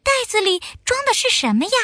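put-on high, quick little girl voice; little girl bear voice. A narrator's reading. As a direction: call out like an eager little cub. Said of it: "小熊妹妹说".

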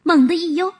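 usual speaking voice

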